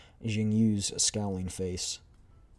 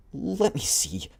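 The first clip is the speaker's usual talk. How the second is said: put-on girl voice; 8 semitones higher, 5 dB louder, slower than usual. Same girl voice.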